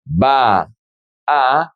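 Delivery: "Va a" as two separate vowels, pause between; separate words. Shown as a demonstration. Teacher showing the words before joining them, said separately. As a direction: say "Va", stop, then "a" on its own. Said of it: "how do we pronounce these words together".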